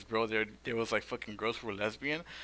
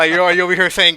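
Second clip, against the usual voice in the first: loud, raised accusing retort; retold angry call-out; mad; 7 semitones higher, 19 dB louder than usual. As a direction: throw it back at them loudly. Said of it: "Why you sound so mad?".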